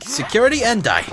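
loud, high attack shout; playful war cry; mock battle cry. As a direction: raise the voice as you strike. Launches attack on enemy.

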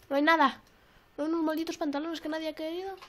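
ordinary talk

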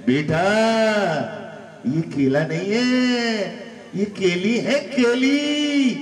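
a hint of a teasing mother; mock coaxing mum. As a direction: coax the kid in a drawn-out teasing lilt. Mother coaxing child again.

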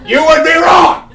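high, loud announcer yell; mock-indignant shout. Host corrects those expecting cheerleading, mock-indignant shout.

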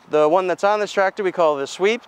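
ordinary delivery

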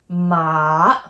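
long MA dipping low and back up; third tone. Shown as a demonstration. Teacher showing the down-and-up tone, dipping MA. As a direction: pitch sags then climbs on MA. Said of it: "it goes down and up".

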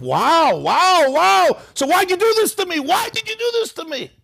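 high, rising cry of wounded protest; hurt outcry. Retold or imitated. The abused person finally protesting, high and pained. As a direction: cry out at the one who hurt you. Voicing the person's anger at God.

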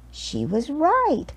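usual speaking voice

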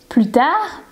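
plain reading voice

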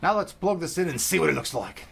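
gruff hammed-up pirate voice; pirate accent. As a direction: growl it like a pirate. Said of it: "to do that crazy accent anymore".

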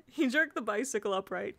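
plain reading voice

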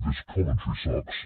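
sign-off in an artificially deep voice; low-pitched voice. Pretend. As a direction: pitch dropped right down by an effect. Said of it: "stop low pitching his voice".

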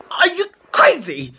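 high-pitched, incredulous cry; exasperated outburst. Real, not acted. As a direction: burst out in disbelief. Scolding a player who walks back.

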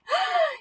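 very high sharp gasp; surprised gasp. Acted. Ventriloquist feigns amazement again.

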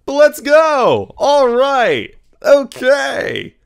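high, loud, drawn-out celebration; excited cheer. Real, not acted. Gamer celebrating a win, loud high cheer.

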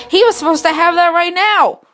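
high, loud alarmed outburst; high, loud Undyne suddenly remembering; sudden realization. Acted. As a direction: burst out as it dawns on you. Undyne remembers the missed lesson.

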